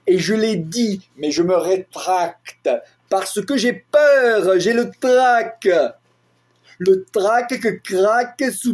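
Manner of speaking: deliberate poetry recital with dramatic pauses and swells; spoken-word poem. Acted. Poet performing a text on speech and defence, dramatic recitation. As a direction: recite it as impassioned slam poetry, leaning on echoed sounds.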